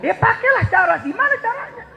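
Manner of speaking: high, light squeak of a question; high mock question; mock puzzled. Mock voicing a puzzled listener.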